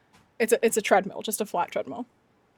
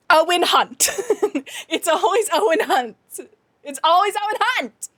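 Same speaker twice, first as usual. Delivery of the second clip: high, exasperated outburst with laughter; laughing exasperation; 5 semitones higher than usual. Real, not acted. Streamer blaming Owen Hunt for every plot disaster, comically exasperated. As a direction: blame him in comic outrage, pitch climbing.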